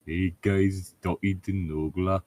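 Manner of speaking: normal talking voice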